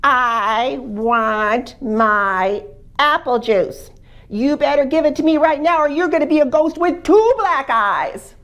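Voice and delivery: put-on baby turns the threat back on the ghost; defiant baby. Baby to the ghost in the cellar, loud defiant high threat.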